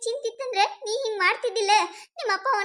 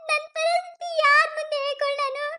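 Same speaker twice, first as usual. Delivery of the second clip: high tearful pleading, voice breaking; crying; 5 semitones higher than usual. Acted. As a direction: pour out her despair through sobs. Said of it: "ಅಳ್ಬೇಡ". (ಅಳುತ್ತಾ ತನ್ನ ಸಂಕಟ ಹೇಳಿಕೊಳ್ಳುವ ಜಾನು)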